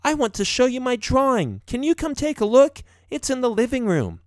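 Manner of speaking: put-on very high little-girl voice; eager young daughter. A narrator's reading. Daughter showing dad her drawing, eager child voice. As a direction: pipe up high like a child asking.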